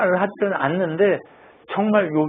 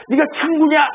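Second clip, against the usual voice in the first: acted indignant outburst, much higher pitch; high-pitched mock confrontation; sudden flare-up; 10 semitones higher, 3 dB louder than usual. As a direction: flare up at a so-called friend. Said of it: "갑자기 발끈해가지고".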